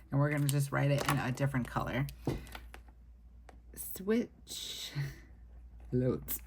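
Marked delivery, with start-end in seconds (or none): 4.0-6.4 words broken by hiccups. Real, not acted. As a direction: speak between hiccups. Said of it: "I have, like, the hiccups".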